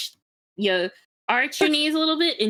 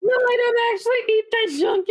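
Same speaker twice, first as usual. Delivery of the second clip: emphatic denial; 9 semitones higher, faster than usual. Real, not acted. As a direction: shout the denial as if in capitals. Reading chat reply aloud.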